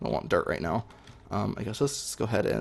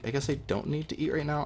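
ordinary talk

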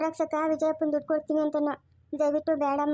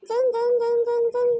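put-on high childish vroom sounds, jun jun; child's car noise; 8 semitones higher, 4 dB louder than usual. Child playing alone making high car noises. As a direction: buzz like a toy car, in a little kid's voice.

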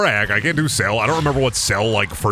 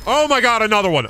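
high exclamation, a bit louder; excited; 10 semitones higher, 3 dB louder, faster than usual. Real, not acted. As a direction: cry out in high-pitched disbelief. Excited cry at another find.

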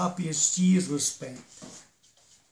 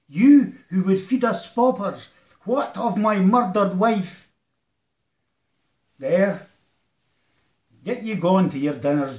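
bitter accusation; 2 semitones higher, 4 dB louder than usual. Acted. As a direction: hurl it at the guardians. Widower accuses the parish guardians.

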